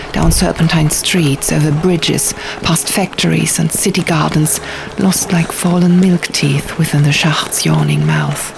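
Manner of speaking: plain reading voice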